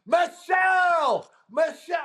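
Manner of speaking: high, drawn-out shout of a name; yelling out. Retold or imitated. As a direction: holler her name across a huge crowd. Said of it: "yells out in front of three thousand people".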